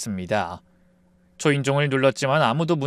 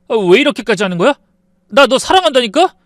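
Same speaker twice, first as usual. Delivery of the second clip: desperate pleading shout; 8 semitones higher, 8 dB louder, faster than usual. Acted. Husband pleads in quarrel.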